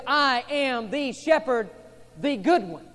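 slow, loud, with good hit hard; emphasis on good. Shown as a demonstration. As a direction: lean hard on good, slowly. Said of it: "that word good is emphatic".

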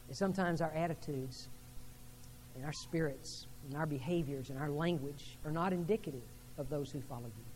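hushed closing prayer. Acted. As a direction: pray quietly for the church. Pastor leads closing prayer.

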